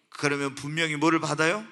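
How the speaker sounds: ordinary talk, nothing marked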